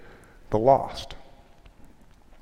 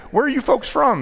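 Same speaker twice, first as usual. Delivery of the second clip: put-on folksy Tennessee accent, pitched up. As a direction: play a chatty Tennessee local. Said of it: "in this really fine Tennessee accent".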